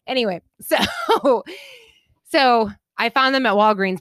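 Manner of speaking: ordinary talk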